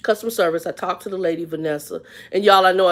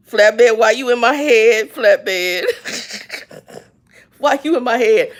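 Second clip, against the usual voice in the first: laughing; 4 semitones higher, 6 dB louder, slower than usual. Real, not acted. Laughing at the intrusive thought.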